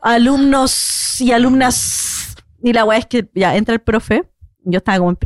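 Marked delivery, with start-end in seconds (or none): 0.0-2.3 long hissed S ending "alumnos" and "alumnas"; imitated teacher's sibilance. Student imitating maths teacher, slow hissing S on "alumnos y alumnas". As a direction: drag out each final S in "alumnos y alumnas". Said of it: "porque hablaba así".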